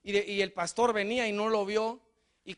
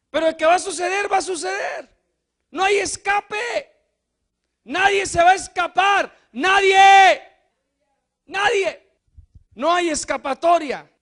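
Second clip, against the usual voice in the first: loud preacher's warning, higher; predicación enérgica; 6 semitones higher, 7 dB louder than usual. Acted. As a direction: warn there is no way out. Preaching at full force. (advertencia predicada en voz alta)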